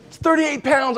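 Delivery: comic retold disbelief; incredulous protest. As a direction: balk at an absurd demand in a high voice. Retold protest at weight target.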